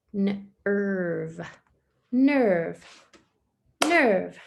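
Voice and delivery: "nerve" punched as one clapped beat; clapped syllables. Shown as a demonstration. Teacher claps "nerve" with one beat for pupils. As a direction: give "nerve" one firm clap. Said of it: "clapping syllables for pupils".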